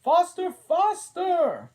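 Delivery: very high, loud, slow cry; cried out. A narrator's reading. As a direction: drag it out in a shrieking urge.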